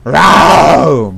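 loud high battle roar rau; barbarian roar. Pretend. Gamer charging in like a barbarian, loud roar. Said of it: "Barbar gibi dalacağım".

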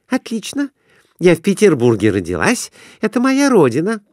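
put-on high, girlish voice; eager wife asking to place a bet; young wife's voice. A narrator's reading. Wife delighted.

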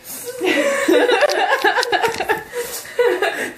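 long high giggling fit; helpless laughter. Real, not acted. Laughing with a friend over the food, helpless giggles. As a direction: dissolve into high squeals of laughter.

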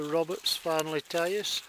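normal talking voice

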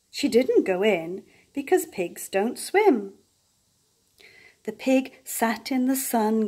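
usual speaking voice

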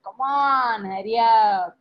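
loud cheer. Retold or imitated. Excited Devas in the audience shouting at Rama, loud cheer. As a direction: cheer him on from the crowd. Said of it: "maybe he would have said loudly also".

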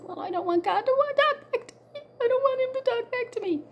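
put-on high, small voice of a frightened child; scared little child. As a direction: play your younger self, afraid God will answer. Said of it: "I said".

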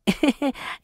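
high rueful laugh. Acted. Laughs at own misfortune.

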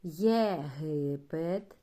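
Єдина, єхидна, Європа drawn out slowly; slow model reading for children; slow syllable reading. Shown as a demonstration. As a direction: read each word syllable by syllable. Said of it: "Спробуємо прочитати слова".

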